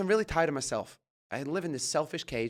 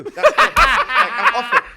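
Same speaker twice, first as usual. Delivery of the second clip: much higher and louder, slow, through laughter; nearly shrieking with laughter as he quits; laughing exclamation; 15 dB louder, slower than usual. Real, not acted. Laughing, quitting the job.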